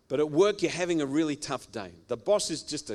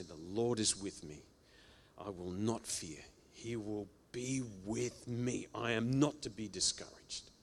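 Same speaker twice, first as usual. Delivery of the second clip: quiet confession; 5 semitones lower, 9 dB quieter than usual. Shown as a demonstration. Confession spoken to oneself.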